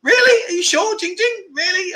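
high, squeaky "really?" of playful disbelief; teasing the girl who called Jimmy handsome, high mock disbelief. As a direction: pretend you can't believe the girl means it. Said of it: "I'll be like".

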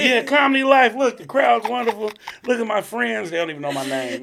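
loud, high fake hype; mock bragging. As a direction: show off like a fake big shot.

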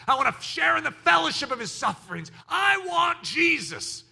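rising to a high, stretched declaration; impassioned cry. Real, not acted. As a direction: build into fervent preaching. Impassioned declaration of longing for Jesus.

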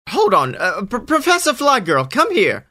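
high, stammering nervous call; flustered bully. Acted. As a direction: caught off guard, calling the teacher. Snakeman startled, calls teacher.

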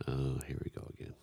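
fast, whispered eye-roll; whispered aside. Real, not acted. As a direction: mutter it under your breath, teasing. Teasing aside during banter.

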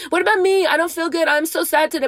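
high-pitched mock whining; mock self-pity. Mimicking someone who thinks only of number one, mock whine. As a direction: whine it high, making fun of the complainer.

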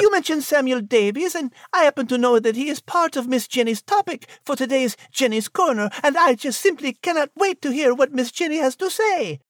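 high cat voice, sweetly eager; the cat gently steering the host to Miss Jenny; high feline voice, polite and impatient; Liz the cat, eager. Acted. Liz character chatting with host.

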